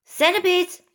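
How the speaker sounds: high-pitched excited call; mild shout. A narrator's reading. Travelers summon the centipede to see the rainbow, mild shout. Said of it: "they shouted".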